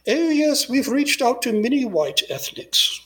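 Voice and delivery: high, gracious society-lady voice, pitched well up; prim waspy matron. Retold or imitated. Retelling a friend's interview with a waspy matron, high prim voice. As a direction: mimic a condescending old-money hostess. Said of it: "very waspy lady".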